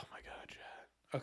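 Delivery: slow, voiceless sigh of words; deflated whisper. Real, not acted. Streamer to chat after failing quiz, deflated whisper. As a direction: whisper it slowly, defeated.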